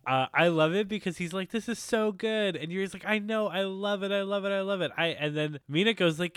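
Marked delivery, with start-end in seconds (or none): 1.5-2.5 higher, savoring every word slowly; lightly acted foodie rapture; mock delight. Host voicing Victor tasting pork cutlet bowl, delighted. 3.0-4.9 higher-pitched eager repetition; mock gushing. Host voicing Yuri agreeing about his favorite food, gushing.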